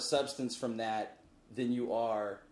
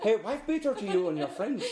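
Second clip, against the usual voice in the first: laughing mock accent; 9 semitones higher, 5 dB louder than usual. Mock Scottish greeting, laughing.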